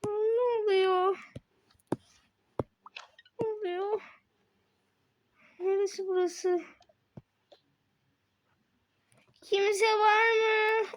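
high, frightened and slow; a lost child, high and afraid; scared small voice. Acted.

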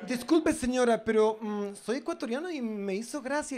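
a hint of a meek polite lad. Young man voiced politely. (el chico ecuatoriano, educado y agudo)

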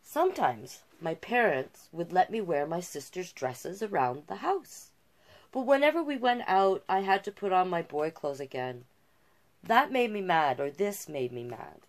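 usual speaking voice

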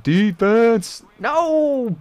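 high, loud yell of dismay; anguished yell at the screen; desperate shout. Real, not acted. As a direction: scream for the stop, then despair. Last-second touchdown against.

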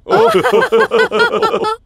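loud, high jeering laugh; mocking laugh. Brother mocking Nolan over the jester job, high jeering laugh. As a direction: laugh right in his face.

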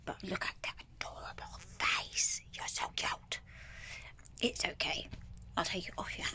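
hushed, voiceless and gentle; tender whisper while removing a tick; soft whisper to the cat. Real, not acted. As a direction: whisper soothingly to a pet. Soothing the cat.

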